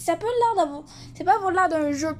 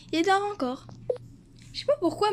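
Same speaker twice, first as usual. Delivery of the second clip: higher mother voice; mom voice; 6 semitones higher, faster than usual. Acted. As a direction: speak as a caring mom. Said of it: "des voix de maman".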